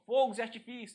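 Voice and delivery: closed o in "Fogos"; high-pitched "Fogos", first vowel closed, as a mistaken form. Shown as a demonstration. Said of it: "não é assim que você fala".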